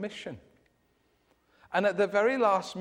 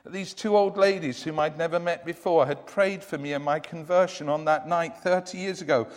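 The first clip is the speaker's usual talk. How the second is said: ordinary talk, nothing marked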